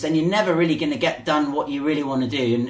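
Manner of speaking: ordinary talk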